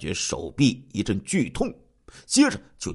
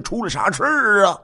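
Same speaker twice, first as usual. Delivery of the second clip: higher-pitched, loud call; raised-voice demand to know; loud questioning; 11 semitones higher, 4 dB louder than usual. A narrator's reading. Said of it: "大声询问".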